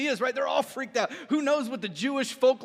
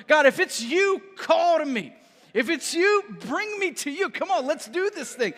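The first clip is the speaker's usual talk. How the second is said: high, raised voice, urging God on; eager calling out; 5 semitones higher, 3 dB louder than usual. Acted. Believer asks God to call him onto the water, calling out eagerly. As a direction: call upward with bold eagerness.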